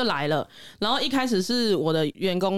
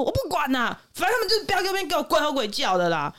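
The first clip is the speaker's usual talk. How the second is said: higher imitated demanding owner voice; a hint of the pushy cat owner; stubborn customer; 6 semitones higher than usual. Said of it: "他说".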